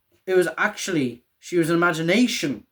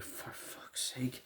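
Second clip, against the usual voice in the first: slow, exasperated whisper, much softer; Hopper's weary whisper; 19 dB quieter, slower than usual. Retold or imitated. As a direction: sigh it out under your breath, fed up. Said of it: "cuts to Hopper, like".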